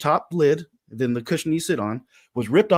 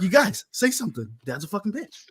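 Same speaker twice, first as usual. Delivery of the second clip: disappointed youngest son; 5 semitones higher than usual. Retold or imitated. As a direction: lightly imitate a small boy urging his brothers. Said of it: "he was so disappointed".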